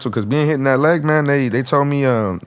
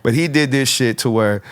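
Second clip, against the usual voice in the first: ordinary talk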